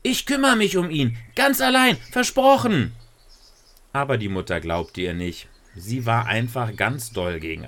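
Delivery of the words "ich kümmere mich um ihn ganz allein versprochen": put-on high, loud little girl promising; young Lisa swearing she'll do it all alone; pleading girl. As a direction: beg like an eager child, much higher and louder. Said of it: "Und Lisa sagte".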